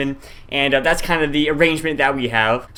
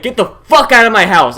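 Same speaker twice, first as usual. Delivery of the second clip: loud, forceful, higher voice; retold yell; 4 semitones higher, 7 dB louder than usual. Retelling confronting someone in the house, forceful raised voice. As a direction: order an intruder out. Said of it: "straight up just yelled, like".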